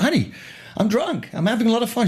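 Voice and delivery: put-on high, happy drunk voice; tipsy and cheerful. Drunk man answering his wife.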